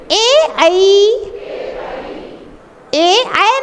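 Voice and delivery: "ऐ" as a gliding a-ee after a long "ए"; correct vowel demo. Shown as a demonstration. Teacher correcting a common vowel mistake, careful pronunciation demo. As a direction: hold "ए", then glide "ऐ" from a to ee. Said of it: "बहुत सारे लोग ऐ को ऐ बोलते हैं".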